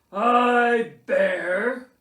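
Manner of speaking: loud, ceremonial bear voice; pompous Bear. A narrator's reading. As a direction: proclaim it like a testament. Bear dictates his will.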